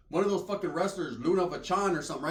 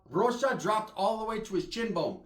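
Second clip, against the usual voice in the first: normal talking voice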